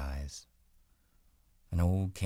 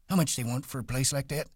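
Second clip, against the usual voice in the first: put-on much higher, fast old man; excitedly; 10 semitones higher, much faster than usual. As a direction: rush the question, hopeful. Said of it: "Candy went on excitedly".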